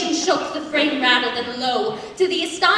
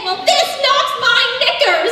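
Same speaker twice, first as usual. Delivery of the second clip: high, loud comic dismay; crying out in dismay; 8 semitones higher, 6 dB louder than usual. Acted. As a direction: wail it slowly like a shocked pig. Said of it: "causing Big Two to cry out in dismay".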